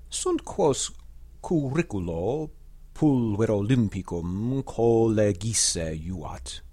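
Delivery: slow, measured Latin verse reading; poetry recitation. Acted. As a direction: declaim the ode with careful pauses. Recites Horace's ode in Latin.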